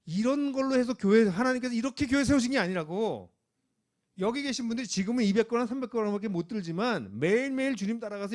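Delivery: loud, driving sermon climax; fiery preaching. Acted. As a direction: preach it at full force to rouse the church.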